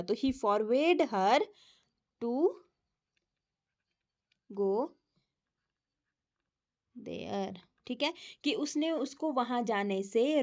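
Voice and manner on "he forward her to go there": very slow, settling down at the end; slow corrected dictation. Shown as a demonstration. Teacher dictating the corrected sentence. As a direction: dictate the fixed sentence slowly. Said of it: "not नहीं आएगा".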